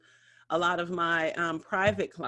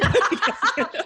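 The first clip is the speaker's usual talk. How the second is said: high burst of laughter; 12 semitones higher, 8 dB louder than usual. Real, not acted. Amused by the story.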